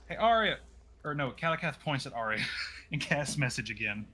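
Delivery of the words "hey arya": higher, louder hail; calling out. Acted. Game master's character hails a party member, calling out. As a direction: call to get someone's attention.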